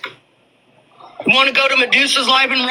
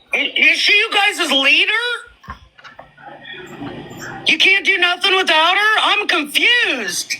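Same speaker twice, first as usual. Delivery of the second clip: high sarcastic disbelief; mock confusion; 8 semitones higher than usual. Real, not acted. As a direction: feign confusion, pitch up. Mocking rivals' dependence.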